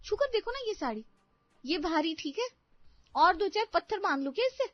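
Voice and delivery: high-pitched, exasperated complaint; angry young wife. Acted. Wife complains to husband about the heavy sari, angry. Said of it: "रिया गुस्से में".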